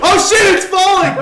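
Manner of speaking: loud, high yell; hammed-up panicked shout; mock panic. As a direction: shout a staged alarm. Staged panic as object falls.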